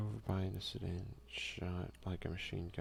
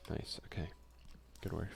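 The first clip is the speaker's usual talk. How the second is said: ordinary delivery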